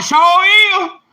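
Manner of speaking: high, loud congregation shout; mock holler from the crowd; church hollering. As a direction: call it out like the pews. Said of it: "started hollering".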